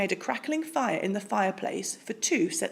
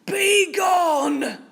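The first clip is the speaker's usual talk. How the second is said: loud roar; 9 semitones higher, 7 dB louder than usual. A narrator's reading. Bear roars at the mouse, loud. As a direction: roar him out the door. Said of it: "roared Bear".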